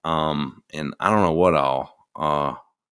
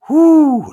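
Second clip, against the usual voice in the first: a high, loud joyful whoo; food delight whoop; 10 dB louder than usual. Real, not acted. Thinking of gravy on mashed potatoes, a loud happy whoo. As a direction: whoop with pleasure at the thought. Raving about Thanksgiving food.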